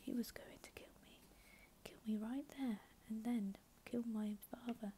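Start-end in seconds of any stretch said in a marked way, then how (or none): none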